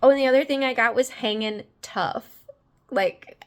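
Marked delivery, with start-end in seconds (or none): none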